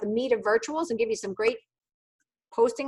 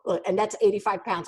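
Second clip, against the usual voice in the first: ordinary talk, nothing marked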